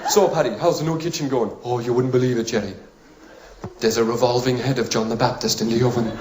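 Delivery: mock Irish pub regulars trading news; Irish pub chat. Two Irishmen discussing a new kitchen, Irish accent. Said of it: "I wanna hear in an Irish pub".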